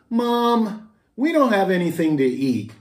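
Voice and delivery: put-on high whining child voice; whiny kid. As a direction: complain like a hungry boy. Kid complaining to mom.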